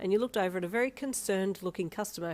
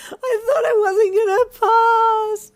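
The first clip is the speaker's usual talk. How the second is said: slow, high, loud sobbing wail; 18 dB louder, much slower than usual. Acted. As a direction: wail it through tears of relief. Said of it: "you wailed loudly".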